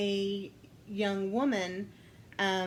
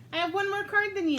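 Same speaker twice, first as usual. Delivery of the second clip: usual speaking voice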